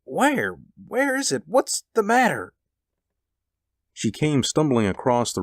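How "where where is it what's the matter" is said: high, startled questions; alarmed. A narrator's reading. Husband startled awake by his wife's cry, alarmed. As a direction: ask as if jolted awake. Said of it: "He started up in alarm".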